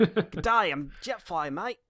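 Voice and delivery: hammed-up Aussie greeting voice; Australian accent. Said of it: "and Australian".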